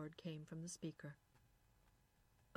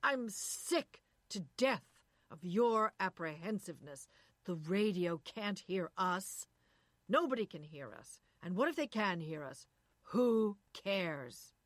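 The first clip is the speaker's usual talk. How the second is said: shouting; 7 dB louder than usual. Acted. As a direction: bellow it out in disgust. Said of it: "he shouted".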